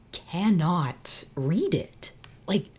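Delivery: normal talking voice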